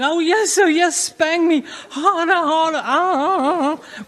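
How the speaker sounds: high, loud hammed-up moans; mock moaning woman. Comic imitating a woman during sex, high hammed-up moaning. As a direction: ham up a woman begging in bed. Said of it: "she'll be going".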